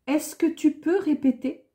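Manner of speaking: usual speaking voice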